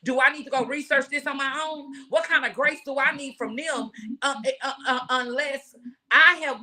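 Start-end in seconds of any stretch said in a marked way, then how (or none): none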